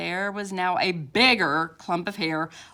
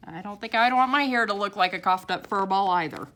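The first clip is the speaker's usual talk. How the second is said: usual speaking voice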